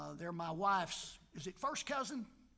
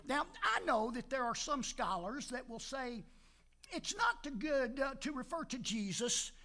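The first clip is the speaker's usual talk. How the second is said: raised, ringing pulpit delivery; fired-up preaching; 5 semitones higher, 5 dB louder than usual. Acted. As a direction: preach it out to the congregation. Preaching resumes.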